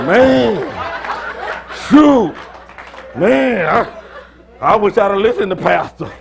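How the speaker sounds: loud, laughing lament of a future self; wailing over lost chances, laughing; mock regret. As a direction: ham up the regret, half laughing.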